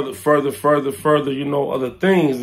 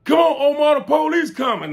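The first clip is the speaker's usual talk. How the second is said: retold yell; 11 semitones higher than usual. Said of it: "somebody yelled out".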